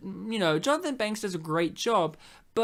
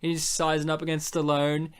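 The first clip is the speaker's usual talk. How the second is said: usual speaking voice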